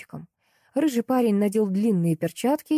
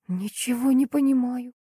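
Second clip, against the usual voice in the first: higher, plaintive little protest; 5 semitones higher than usual. A narrator's reading. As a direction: whine it pitifully, pitch raised. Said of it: "жалобно сказала я".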